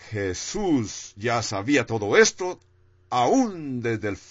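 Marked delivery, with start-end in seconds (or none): none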